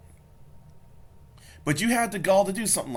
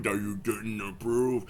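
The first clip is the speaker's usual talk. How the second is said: mock deep voice; 6 semitones lower than usual. Repeats line slower and deeper.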